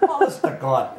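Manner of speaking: high, laughing exclamation; real laughter. Real, not acted. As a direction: laugh through the words in disbelief. Laughing at own memory.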